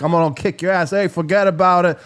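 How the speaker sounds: a hint of a New York tough guy talking trash; tough New Yorker. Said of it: "But then there's New Yorker, like".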